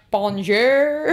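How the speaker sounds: loud, put-on French accent; mock-French greeting with a laugh. Shown as a demonstration. Recalling the improv show, hammed-up French accent. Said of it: "with a French accent".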